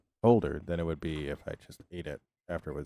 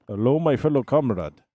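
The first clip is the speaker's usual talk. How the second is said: put-on Russian comrade voice; hammed-up Russian accent; 5 dB louder than usual. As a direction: greet a fellow agent in mock Russian. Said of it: "I can do Russian".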